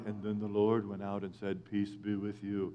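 low, soft, droning scripture voice; mock the dull pious drone; monotone church reading. Mocking how church reads the Bible, in a low soft monotone. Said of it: "it's all monotone and like that".

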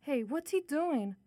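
ordinary talk

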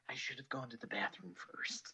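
soft whispered aside to himself; whispering mid-podcast about the bathroom; whispered regret. Retold or imitated. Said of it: "I went".

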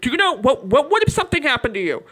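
high, fast panicked worry; retelling a dad who just lost his son. Retold or imitated. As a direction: blurt it out like a frantic father. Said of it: "Just losing his mind".

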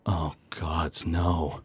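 low, hushed dread; Moenzi Freedom Peak. Acted. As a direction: let it sink out, soft and low. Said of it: "you see Moenzi Freedom Peak".

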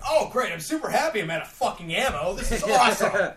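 loud, high mock delight; sarcastic anger. Gamer retelling running out of ammo, angrily sarcastic. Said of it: "I get sarcastic as shit".

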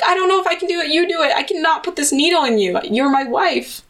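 high, flustered refusal; squeaky nervous husband; high panicked husband. Retold or imitated. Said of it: "he was like".

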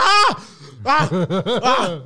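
high shrieking screams, waking from a nightmare; retold nightmare screams, hammed up; mock terrified screams. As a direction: scream out like you just woke up terrified.

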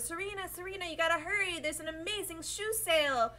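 put-on high, hurried Brooklyn accent. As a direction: call out urgently in a Brooklyn accent. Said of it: "in her Brooklyn accent".

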